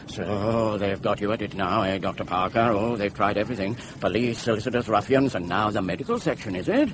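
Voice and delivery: low, soft, quick accusing; suspicious accusation. Acted. Patient accuses Doctor Parker of joining the plot, low suspicion. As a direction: drop low and rattle off the suspicions softly.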